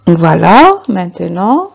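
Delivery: ordinary talk, nothing marked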